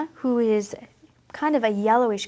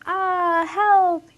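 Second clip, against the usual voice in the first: higher, lightly acted cry for help; mock shout; 7 semitones higher, 4 dB louder than usual. Teacher retelling the sloth's fall to children, mock shout. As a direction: retell his call for help a touch raised. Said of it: "He shouts".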